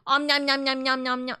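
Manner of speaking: playful chewing noises; nom nom chomping. Pretend. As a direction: chomp it up like a cartoon. Eating sounds over food talk.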